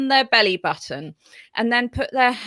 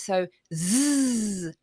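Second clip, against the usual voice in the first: buzzing zzz; 3 dB quieter than usual. Shown as a demonstration. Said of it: "do some voiced sounds".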